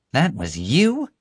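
high, rising shocked question; incredulous. A narrator's reading. Officer learns prisoner set the fire, incredulous. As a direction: swoop up in disbelief.

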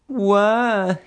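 very high, drawn-out wail; bawling. A narrator's reading. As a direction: bawl it like a crying child, hammed-up. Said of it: "he bawled".

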